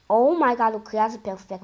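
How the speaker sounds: ordinary talk, nothing marked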